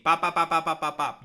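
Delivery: loud playful bop bop noises; loud bopping sound effect. Pretend. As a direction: bop him like a cartoon hit. Sound effect of transing him.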